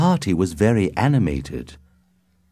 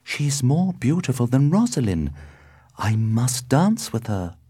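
higher, smitten wonder; lovestruck exclaiming; 6 semitones higher than usual. A narrator's reading. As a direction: marvel at a new love, pitch raised. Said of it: "he exclaimed".

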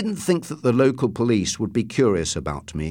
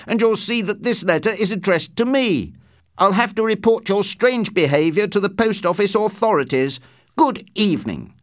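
higher-pitched, officious reprimand; indignant professor; 8 semitones higher than usual. A narrator's reading. Man posing as professor threatens to report postmistress, indignant. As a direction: play the affronted academic, voice raised in pitch. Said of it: "act the part of the professor".

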